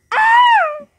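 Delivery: high, loud shriek in the dark; terrified scream. Acted. Player caught in the dark, terrified scream. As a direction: shriek as something grabs you.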